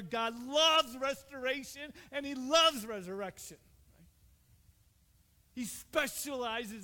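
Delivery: loud, fervent sermon climax; preaching at full force. Acted. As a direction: drive the message home to the congregation.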